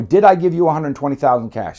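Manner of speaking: loud, high accusing question; angry demand. Retold or imitated. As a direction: lean on the I, demand an answer. Grilling Sal at meeting.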